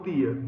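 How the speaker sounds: "dia" with a plain hard d; d dental. Shown as a demonstration. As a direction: say "dia" like "dee-a", without a j sound. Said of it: "seguindo esse padrão de pronúncia". ("dia" com d seco)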